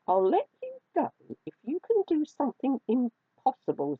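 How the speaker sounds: high, lilting kitten voice; a touch of sly amusement; amused teasing. A narrator's reading. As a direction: tease the mouse playfully. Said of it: "said Fluffy with a chuckle".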